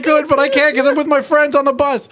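hammed-up preteen whine; mock twelve-year-old. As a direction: kid on the school bus. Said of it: "you were like".

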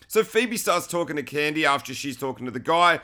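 normal talking voice

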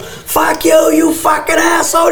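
Harvey Keitel yelling. Retold or imitated. Retelling Harvey Keitel's gun scene, mock yell. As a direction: fire the insult off at him. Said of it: "and Harvey Keitel".

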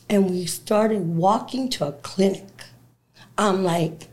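ordinary talk

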